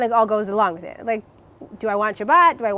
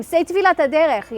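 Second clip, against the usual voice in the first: higher, louder demand; retold scream; 7 semitones higher, 5 dB louder than usual. Scared girlfriend shouting at her boyfriend to say the travel prayer, louder. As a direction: shout the order at him.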